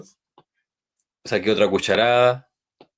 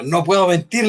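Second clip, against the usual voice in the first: nervous; 6 semitones higher than usual. Retold or imitated. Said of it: "en un acto así muy nervioso".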